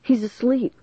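ordinary delivery

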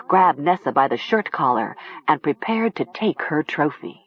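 ordinary delivery, nothing marked

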